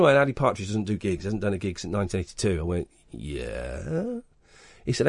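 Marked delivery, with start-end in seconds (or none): 3.1-4.2 low, soft, rising disbelief; a hushed, awestruck yeah?; barely daring to ask; stunned quiet yeah. Retold or imitated. Retold stunned reply on phone.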